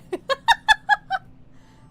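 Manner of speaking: loud shrieking burst of laughter; loud high laugh. Real, not acted. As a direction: cackle out loud, high and long.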